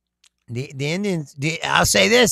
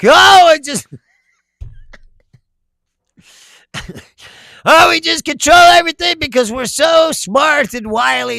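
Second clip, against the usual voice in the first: mock-smug, higher and louder; 9 semitones higher, 7 dB louder than usual. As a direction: sneer it like a self-satisfied conqueror. Mocking self-satisfied Westerners.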